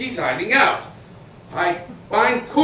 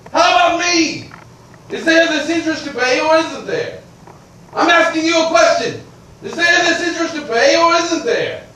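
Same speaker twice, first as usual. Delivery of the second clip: angry demand; 7 semitones higher than usual. Acted. Creditor demanding his interest from the widow, angry. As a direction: press her hard, voice raised and impatient.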